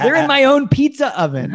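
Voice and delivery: high voice breaking into laughter; laughing. Real, not acted. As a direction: say it through a laugh. Joke said while laughing.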